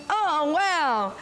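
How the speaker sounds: high, swooping retold wow; touched amazement. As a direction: react amazed, pitch lifting well up. Retold reaction to boss's blessing.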